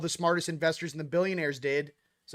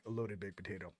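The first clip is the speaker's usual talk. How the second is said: deep, soft bro voice savoring the words; lazy low "bro" delivery; low mock-cool voice; 9 semitones lower, 14 dB quieter, faster than usual. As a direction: drop low and soft, laid-back. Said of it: "I'm like, I'm like".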